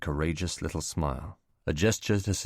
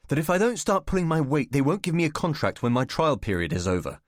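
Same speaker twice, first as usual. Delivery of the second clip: agitated; 8 semitones higher, 4 dB louder than usual. Acted. Alec upset, voice rising.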